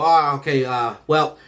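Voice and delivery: low, halting official voice full of uhs; hesitant, formal principal delivering bad news; school principal. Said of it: "So now they explain to my mom".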